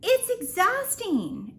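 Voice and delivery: high, loud, slow wail; exasperated wail. Real, not acted. Vlogger complaining to viewers, a high slow exhausted wail. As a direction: drag it out high and loud, worn out.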